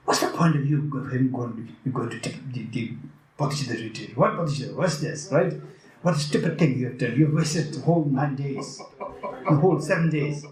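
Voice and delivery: ordinary delivery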